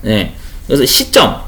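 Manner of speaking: usual speaking voice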